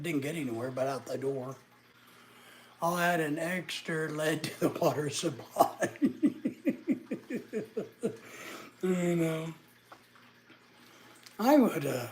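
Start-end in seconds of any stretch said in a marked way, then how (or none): 5.9-8.8 laughing. Real, not acted. Laughs at own joke.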